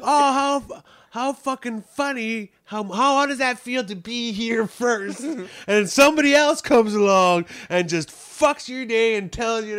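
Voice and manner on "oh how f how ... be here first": high, slow sarcastic drawl; mocking. Comic mocking the heckler's claim, slow high sarcasm. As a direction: sneer back their own words. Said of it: "And he's like".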